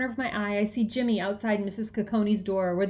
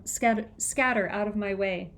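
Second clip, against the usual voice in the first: plain reading voice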